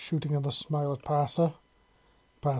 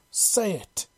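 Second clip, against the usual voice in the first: higher-pitched, growled order; growled command; 5 semitones higher than usual. A narrator's reading. Shepard pressing Parser to repeat the chant, growling. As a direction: growl it as a short demand. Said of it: "Shepard growled once again".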